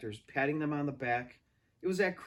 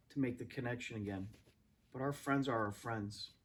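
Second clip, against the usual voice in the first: soft, lower musing; reflective aside; 3 semitones lower, 4 dB quieter than usual. Real, not acted. Reader comments on friendship, soft and reflective. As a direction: muse gently to the listener.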